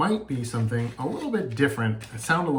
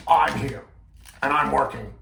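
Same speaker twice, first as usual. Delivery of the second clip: louder annoyed puppet; grumpy yell; 3 semitones higher, 7 dB louder than usual. Acted. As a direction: scold the intruder. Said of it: "starts to yell at him".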